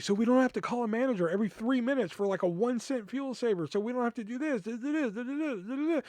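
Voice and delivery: high, rising sing-along list of gripes; retold whiny protest; exasperated complaint. Acted complaint to the assistant manager.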